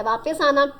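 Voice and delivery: usual speaking voice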